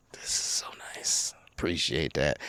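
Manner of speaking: unvoiced, hushed self-satisfied boss; smug boss whisper. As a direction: breathe it out like a smug rich boss. Boss admiring new marble floors.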